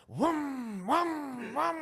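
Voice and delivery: high, loud mock engine revs; engine revving noise. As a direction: rev it like a sports car engine.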